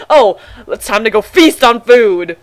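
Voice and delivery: pompous feast announcement; mock grand voice. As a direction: poke fun at the word feast. Mock fancy phrasing.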